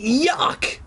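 high disgusted yuck; disgusted cry. Acted. Fake son rejecting liver, a high disgusted cry. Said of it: "he cried out in disgust".

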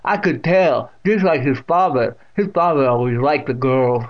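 loud deaf aunt's teasing voice; amused great-aunt. A narrator's reading. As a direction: tease as the tickled old aunt. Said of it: "still chuckling".

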